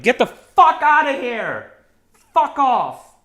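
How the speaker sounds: hammed-up outraged bellow; mock angry yell. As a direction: chase him off, voicing a shrieking mob. Harfoots yelling at deserter.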